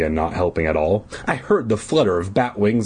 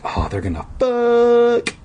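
mocking aside. Real, not acted.

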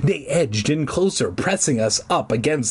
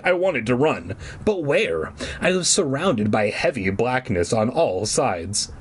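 plain reading voice

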